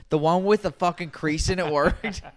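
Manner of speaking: high, loud, incredulous squeal; mock disbelief. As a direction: act like you can't believe it worked. Retelling his disbelief at the news.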